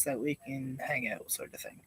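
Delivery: sudden soft whisper. Real, not acted. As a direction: drop to a whisper. Drops voice mid sentence.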